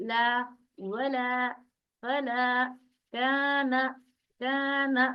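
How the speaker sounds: ला, वला, फला, काना with thin "aa" stretched evenly; long vowel, two beats. Shown as a demonstration. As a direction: drill ला, वला, फला, काना slowly apart, holding each long "aa". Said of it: "दो अलिफ के बराबर खींचते हैं". (ला, वला, फला, काना में अलिफ खींचकर पढ़ें)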